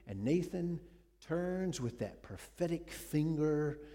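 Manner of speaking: ordinary talk, nothing marked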